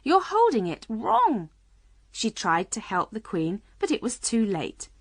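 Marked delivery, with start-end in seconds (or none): 0.0-1.5 high-pitched urgent correction; crying out. A narrator's reading. Alice seeing the Queen mishandle the brooch pin, urgent. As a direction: warn with some alarm. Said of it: "cried Alice".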